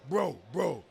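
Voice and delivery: amped-up call. Retold or imitated. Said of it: "He's all amped".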